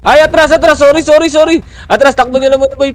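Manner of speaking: high, loud panicked retreat call; urgent shouted callout. Real, not acted. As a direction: shout the team back urgently.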